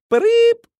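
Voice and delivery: piercing high whistle sound, very loud; whistle blast. A narrator's reading. Narrator mimics the coach's whistle, shrill and loud.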